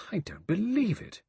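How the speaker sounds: high-pitched disbelief; incredulous. A narrator's reading. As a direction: refuse to believe it, pitch leaping up. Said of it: "Vera said incredulously".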